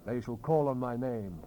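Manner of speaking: usual speaking voice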